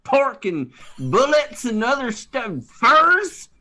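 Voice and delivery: put-on a cranky old prospector griping; cowboy prospector. S0 plays a Western prospector.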